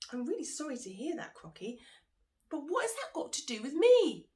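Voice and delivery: sympathy turning to fear; worried Monkey. Acted. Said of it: "Monkey was really worried now".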